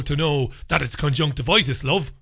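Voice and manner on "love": normal talking voice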